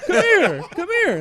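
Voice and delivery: high, loud beckoning call, amused; Austin Carr yelling. Retold or imitated. Austin summoning the author out of line, calling loudly. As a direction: shout someone over from far off. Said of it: "he just yells out".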